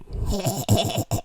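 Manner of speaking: imitated freakish goblin cackle; mock creepy staccato laugh. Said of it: "She does this laugh where she's like".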